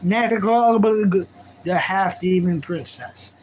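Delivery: very slow, grand title read; slow announcer title. Shown as a demonstration. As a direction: stretch each word out. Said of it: "you'll see how it's said".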